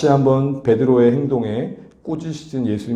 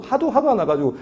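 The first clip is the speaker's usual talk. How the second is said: laughing; 8 semitones higher than usual. Shown as a demonstration.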